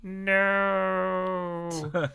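long, high sustained "no"; shrill cry. Acted. Tracy learns she stabbed her friend, shrill cry. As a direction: draw out a thin, high wail. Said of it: "Tracy uttered a shrill cry".

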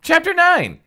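ordinary talk

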